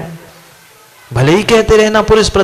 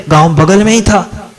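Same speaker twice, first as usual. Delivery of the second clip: usual speaking voice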